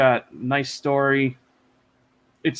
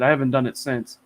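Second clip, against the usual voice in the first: usual speaking voice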